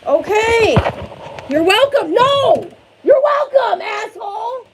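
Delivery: loud, high furious yelling; angry shout. Real, not acted. Mom yelling at her son after he insults her. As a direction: shout back at him, fed up. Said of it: "raise my voice".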